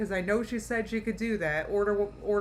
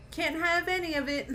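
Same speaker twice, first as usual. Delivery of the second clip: higher, lightly put-on whiny read; mock quote; 8 semitones higher than usual. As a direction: read the comment in a mocking whine. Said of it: "Wellspring Phoenix said".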